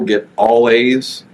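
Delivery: normal talking voice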